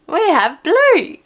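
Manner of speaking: high, loud, drawn-out reveal; big, loud card reveal; excited announcement. Real, not acted. Repeats the yelled card reveal.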